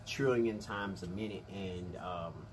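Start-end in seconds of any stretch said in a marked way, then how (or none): none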